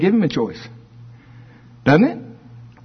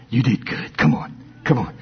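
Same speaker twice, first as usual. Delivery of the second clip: tender comfort; 5 semitones lower, 4 dB quieter than usual. Retold or imitated. God comforting fallen child.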